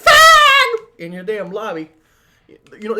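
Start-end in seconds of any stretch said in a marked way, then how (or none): 0.0-1.0 sudden high shrieking yell; mock scream. Gamer mocking lobby screamers, shrieked mock slur. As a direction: scream it like an angry lobby kid. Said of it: "They're not for screaming out".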